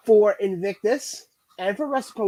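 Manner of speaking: usual speaking voice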